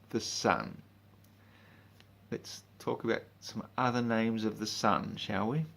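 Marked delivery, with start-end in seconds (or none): none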